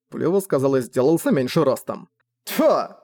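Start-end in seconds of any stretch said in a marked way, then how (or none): 2.4-2.9 raised, short burst of disgust; disgusted bark. A narrator's reading. Commander disgusted with his underling, barking. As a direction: spit out the exclamation. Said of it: "рявкнул Грэм".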